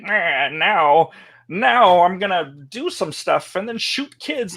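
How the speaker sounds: cartoonish bad guy. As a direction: announce your evil plan like a cartoon villain. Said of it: "he turned really cartoonish as a bad guy".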